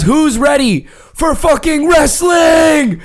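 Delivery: drawn-out, high wrestling-host shout; hype host shout. Retold or imitated. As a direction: rile up the crowd, stretching the words. Excited host hyping the crowd.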